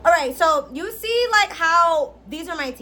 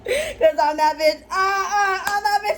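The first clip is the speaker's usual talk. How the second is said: laughing brag; 6 semitones higher, 4 dB louder than usual. Real, not acted.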